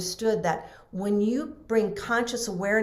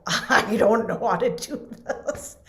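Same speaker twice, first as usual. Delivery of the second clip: put-on slow, higher, laughing helpless kid; 4 semitones higher than usual. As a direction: giggle out the admission. Frightened child at the chalkboard.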